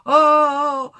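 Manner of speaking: high-pitched fretting with loud, drawn-out "oh"s; hammed-up alarmed wailing; mock panic. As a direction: imitate a nervous worrier overreacting to everything. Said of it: "gonna make 'em panic".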